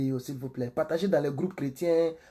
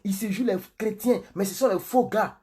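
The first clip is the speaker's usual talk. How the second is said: higher, worked-up scandalmonger; mock gossip broadcast; 6 semitones higher than usual. Pastor mimicking gossip videos that draw big audiences, worked-up mock outrage. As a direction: hype it like juicy scandal. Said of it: "Si je parlais comme ça là".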